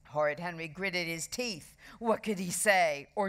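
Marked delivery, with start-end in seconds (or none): none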